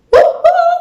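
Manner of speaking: high, loud woo-hoo; celebratory whoop. Retold or imitated. Auditioner hearing they are running behind, celebratory whoop. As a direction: whoop it out in relief.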